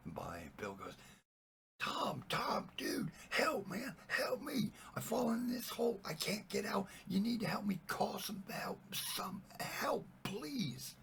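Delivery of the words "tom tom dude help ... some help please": very high, panicky cry for help; hammed-up frantic plea. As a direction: beg a friend desperately, voice pushed way up. Trapped man frantically calls to friend.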